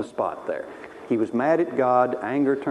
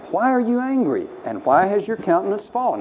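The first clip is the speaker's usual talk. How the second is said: God questioning; 7 semitones higher than usual. Retold or imitated. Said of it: "He says to Cain".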